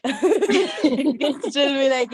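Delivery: amused, laughing aloud. Real, not acted. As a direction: giggle while setting up the joke. Joking about child farting.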